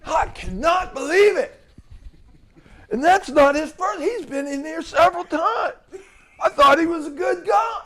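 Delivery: high, loud, laughing fake outrage; laughing impression of overdone shock; mock disbelief. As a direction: parody the liar's shocked act. Speaker mimics the lying employee.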